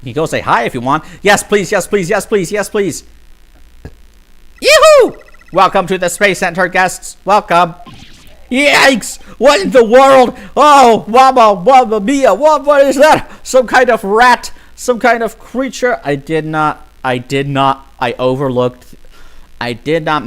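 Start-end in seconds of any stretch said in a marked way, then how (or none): 1.2-3.0 eager begging. Real, not acted. Eagerly begging to meet robot. 4.6-7.7 high, loud chirpy greeter; cheerful robot guide. Acted. Robot guide greeting visitors at the Space Center, high and chirpy. 8.5-16.0 high, loud alarmed yelp; startled shriek. Acted. Heroine spotting the robot, shrieking high in alarm.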